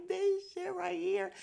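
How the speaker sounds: high, slow excited cheer; thrilled cheer. Real, not acted. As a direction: stretch it out thrilled. Cheering the two together.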